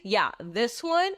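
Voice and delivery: ordinary delivery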